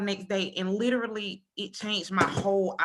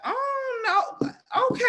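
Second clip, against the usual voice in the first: much higher, hammed-up crying whine; mock whimper; 5 dB louder, slower than usual. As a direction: play it as comic wounded feelings. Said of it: "I be looking like".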